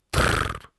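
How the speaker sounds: short rolled insect trill; bug trill. Acted. Bug agreeing to wait for the signal, rolled trill. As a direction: toneless 'trrr' as a bug's reply.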